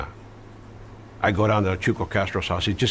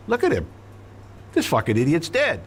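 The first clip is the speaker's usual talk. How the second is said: mocking laugh; 9 semitones higher than usual. Friend mocking the corpse, high voice.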